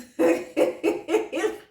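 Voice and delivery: high, bright burst of laughter; joyful laughing outburst; giddy high laugh. Retold or imitated. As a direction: laugh out with pure joy. Showing husband positive tests.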